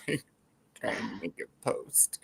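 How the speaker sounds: whispering. Real, not acted. Self-pitying aside, whispered.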